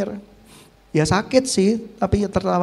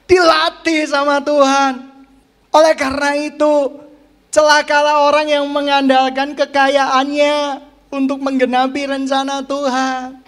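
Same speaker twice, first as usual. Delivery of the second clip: ringing sermon warning, pitched up; forceful preaching rebuke; 5 semitones higher, 5 dB louder than usual. Real, not acted. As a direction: rebuke the congregation from the pulpit.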